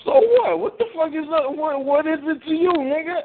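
high-pitched, indignant challenge; defiant retort. Real, not acted. Teased friend answering a scolding, high defiant retort.

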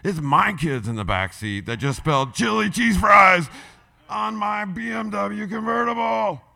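loud and high, almost yelling; shouting. Shown as a demonstration. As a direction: belt it out in outrage. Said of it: "I'm gonna switch the story up".

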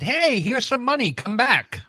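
ordinary talk, nothing marked

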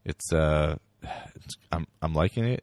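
ordinary talk, nothing marked

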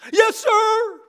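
loud, high yes sir; snapped reply. Retold or imitated. Cadet answering the sergeant at attention: loud, high snap. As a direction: fire it back at attention.

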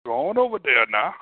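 swooping, sing-song Cajun imitation; hammed-up Cajun, pitch rising and falling; mock Cajun accent. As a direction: put on a thick Cajun accent. Imitating Cajun French speakers.

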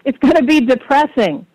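emphatic repeat. Real, not acted. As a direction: say it up and out for someone who missed it. Repeats answer after being asked.

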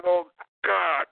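ordinary talk, nothing marked

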